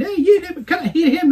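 high, urgent mock telling-off; imitated interrupting lady. Other lady to screamer on dance floor, high urgent correcting. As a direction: put on a high voice to set her straight.

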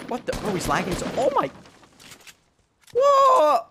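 hyped gamer bro. Real, not acted. Hyped reaction to lagging player.

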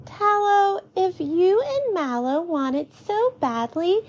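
put-on high-pitched girl's voice; child telling off a friend, pitched high; Beth, chiming in. A narrator's reading. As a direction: chime in high, as a shopkeeper's daughter. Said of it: "Beth chimes in".

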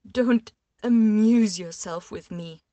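backing away from him, voice trembling. A narrator's reading. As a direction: fling it at him, shaking. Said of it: "her voice trembling".

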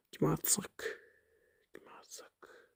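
unvoiced whisper, musing under breath; whisper to self. Real, not acted. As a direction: whisper the question to yourself. Musing to self whom to trade.

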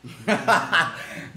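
real burst of chuckles; laughing. Real, not acted. Streamer amused at Virgil's antics, laughing. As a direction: break into laughter.

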